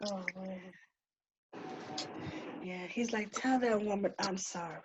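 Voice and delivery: barely voiced, as if moved; soft, subdued relay of a message; hushed. Real, not acted. Relaying apology, voice dropped away.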